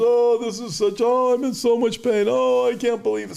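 hammed-up whiny moaning, pitched high; mock wailing. Preacher mimics wailing sinners.

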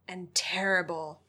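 hushed, spooky storyteller; scary narrator voice. A narrator's reading. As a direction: keep it soft and ominous. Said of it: "that's not a very scary voice".

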